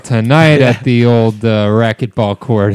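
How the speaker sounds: slow, hammed-up accent for man-bun Colin; put-on man-bun accent. Man-bun Colin bragging about the racquetball court, in a put-on accent. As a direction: drag it out in a silly put-on accent. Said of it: "What is that accent you're doing".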